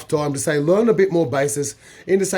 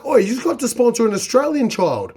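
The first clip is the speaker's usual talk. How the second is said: hammed-up charity ad voice, pitched higher; mock TV appeal; 7 semitones higher than usual. Retelling a TV ad to viewers, in a high mock-pleading voice. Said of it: "and they're like".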